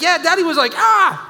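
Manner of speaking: high, loud mock child voice; imitating the son. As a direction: play the four-year-old ratting on dad. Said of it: "He's like".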